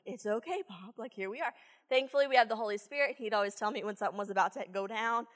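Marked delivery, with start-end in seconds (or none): 0.0-1.5 soft, calming reassurance; soothing. Retold or imitated. Teacher calming an upset boy, soft and soothing. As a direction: talk him down gently. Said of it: "de-escalate him".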